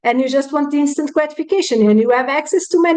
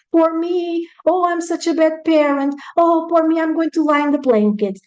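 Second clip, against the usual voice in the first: higher-pitched woe-is-me whine in imitation; mock self-pity; 4 semitones higher than usual. Parent wallowing after messing up, mock self-pity. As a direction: play up the poor-me act. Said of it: "snap out of your self-pity".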